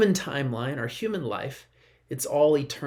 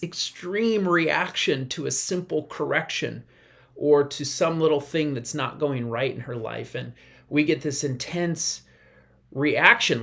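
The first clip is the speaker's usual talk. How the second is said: normal talking voice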